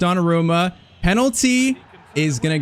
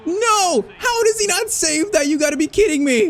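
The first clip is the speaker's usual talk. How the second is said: anguished shout; 12 semitones higher than usual. Real, not acted. Gamer yelling at his keeper after a miss, anguished shout. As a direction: howl at the missed save.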